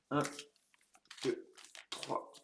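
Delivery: whispered count of the packs. Real, not acted. Counting packs under his breath.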